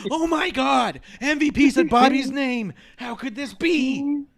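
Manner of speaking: high, loud hammed-up gasp of shock; mock astonishment. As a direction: ham up Drew's disbelief, slowing at the end. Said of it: "And Drew goes".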